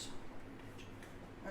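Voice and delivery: slow breathless whisper; whispered curse. Retold or imitated. Seller learning buyer fixed the Jeep, slow whisper. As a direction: hiss it under your breath, slowly.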